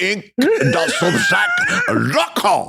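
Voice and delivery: high, exaggerated mock-French accent; wobbly French accent sliding toward British; put-on French accent. Shown as a demonstration. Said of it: "What was that accent?".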